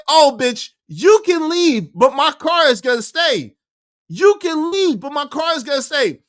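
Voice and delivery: high, loud, outraged; husband yelling the car stays; furious yell. Retold or imitated. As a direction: bellow it at her. Yelling at wife.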